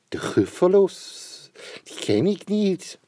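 high, slow puzzled snake. Acted. Snake asks the mouse, high and puzzled. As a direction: snake wonders who that is.

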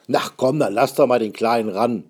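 lauter Ruf eines Musikanten mit fremder Stimme; gutmütig aus der Menge rufen; Zuruf. Acted. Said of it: "Einer ruft". (Musikant ruft laut und gutmütig)

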